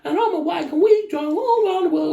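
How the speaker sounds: high-pitched boastful mum parody; very high, put-on proud mother; mock social-media mum. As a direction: brag in a silly high mum voice. Mimicking social media mums.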